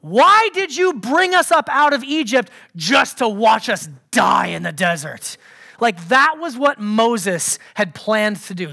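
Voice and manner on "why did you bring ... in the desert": raised, emphatic mock accusation; accusing. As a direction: confront the leader, assuming the worst. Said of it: "assuming the worst".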